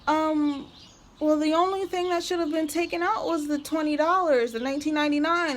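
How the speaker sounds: high, bubbly fake-friendly voice; mock sugary saleswoman. Mocking the saleswoman who signed her up, high fake-cheery voice. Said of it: "She was like".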